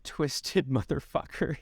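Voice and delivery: usual speaking voice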